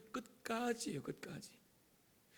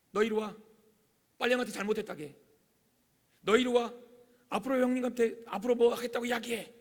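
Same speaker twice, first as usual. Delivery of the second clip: raised, louder commands; bossy mother; 5 semitones higher, 7 dB louder than usual. Acted. Mother ordering younger son to apologize, loud and commanding. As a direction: order the children about as a stern parent. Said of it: "그리고 이렇게 말하죠".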